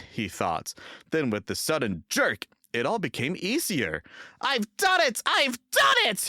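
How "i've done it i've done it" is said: much higher, louder triumph; triumphant. A narrator's reading. James topping the hill, triumphant. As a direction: burst out in triumph. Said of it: "he puffed triumphantly".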